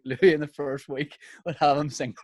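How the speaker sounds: usual speaking voice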